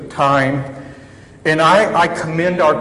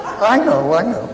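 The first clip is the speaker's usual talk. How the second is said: sheepish laughing admission; 8 semitones higher, 5 dB louder than usual. Retold or imitated. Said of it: "I was like".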